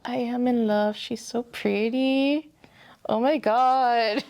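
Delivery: slow, drawn-out adoring delight; smitten gushing. Real, not acted. Co-host admiring her new Storm figure, lovestruck and gushing. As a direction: swoon over it, stretching the words.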